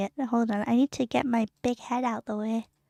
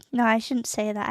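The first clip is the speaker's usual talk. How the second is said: ordinary talk, nothing marked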